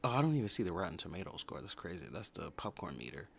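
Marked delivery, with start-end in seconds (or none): none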